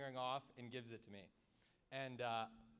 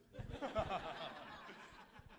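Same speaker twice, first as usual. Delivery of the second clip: real laugh; 4 semitones higher than usual. Real, not acted. Friend finishes his wedding prank story, real laugh. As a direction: burst of laughter after a punchline. Laughs after own punchline.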